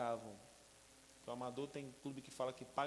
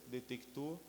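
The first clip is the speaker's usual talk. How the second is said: ordinary delivery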